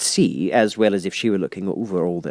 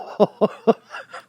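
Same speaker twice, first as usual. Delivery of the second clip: nervous giggle; 12 semitones higher than usual. Acted. Hatter laughs.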